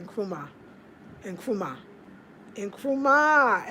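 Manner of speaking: Nkrumah, with the N hummed before a rolled krumah; Ghanaian word for okra. Shown as a demonstration. Teaching viewers the local word, carefully sounding out Nkrumah. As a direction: sound out Nkrumah, stretching the last one. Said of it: "That's how you say okra".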